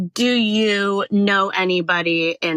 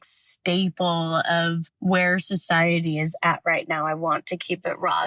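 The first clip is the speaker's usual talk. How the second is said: normal talking voice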